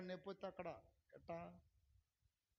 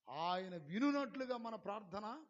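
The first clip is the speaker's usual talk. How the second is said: loud exhortation; 4 semitones lower, 11 dB louder than usual. Real, not acted. Preacher shouting for the church to raise hands. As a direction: call the church up with full voice.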